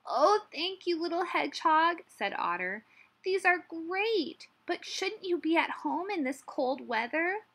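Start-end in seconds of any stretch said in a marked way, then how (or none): 0.1-2.0 high, loud gushing thanks; grateful otter. A narrator's reading. Otter thanking Hedgehog for his mittens, grateful. 3.2-7.4 friendly otter. A narrator's reading. Otter fussing over Hedgehog out in the cold, friendly.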